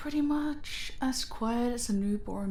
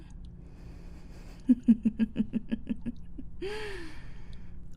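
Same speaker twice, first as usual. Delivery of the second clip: long cackling laugh, higher, breathy; high cruel laugh; 6 semitones higher than usual. Acted. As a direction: revel in her fear. Villain mocking captive.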